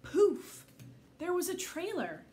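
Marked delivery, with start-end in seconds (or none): 0.0-0.7 loud, high poof; a bright burst of magic. A narrator's reading. Reader makes the godmare's magic go off, loud and high.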